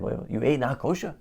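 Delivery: soft, rising question of disbelief; puzzled rabbi. Acted. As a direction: check quietly that you heard correctly. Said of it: "The rabbi is confused".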